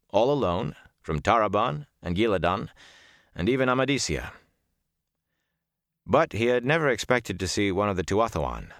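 ordinary delivery, nothing marked

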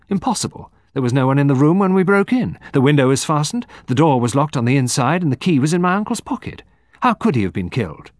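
agitated, quick-fire disbelief; Hugo incredulous. Acted. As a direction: object forcefully, rattling off the facts.

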